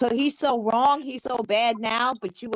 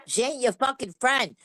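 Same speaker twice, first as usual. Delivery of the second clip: ordinary talk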